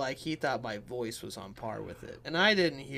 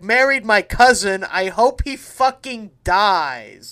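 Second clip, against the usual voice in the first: loud, slow gangster growl; mock mobster rant; Phil Leotardo line; 4 semitones higher, 11 dB louder, slower than usual. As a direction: belt out the mob boss threat. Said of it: "well, I'll do the ending".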